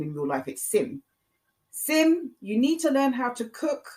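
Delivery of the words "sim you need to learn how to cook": high, louder mock-scolding mother; imitating her mum. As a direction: nag like a mum telling off a teen. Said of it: "my mum said to me".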